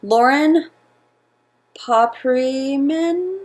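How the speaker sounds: "Papriman" drawn out slowly as a question; halting, questioning attempt at saying "Papriman"; unsure pronunciation. Real, not acted. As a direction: sound out "Papriman" very slowly, guessing. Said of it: "I'm gonna mispronounce this".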